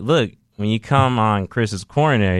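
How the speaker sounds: ordinary talk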